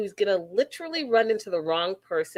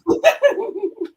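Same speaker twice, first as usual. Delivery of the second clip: high laugh; 14 semitones higher, 5 dB louder than usual. Real, not acted. Laughing at own flub.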